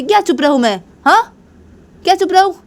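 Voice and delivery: high, indignant retort; acted defiant outburst; yelling back. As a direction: throw the order back, pitch climbing. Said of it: "निशा से चिल्ला रही थी".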